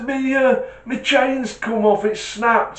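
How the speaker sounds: put-on old man. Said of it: "he says".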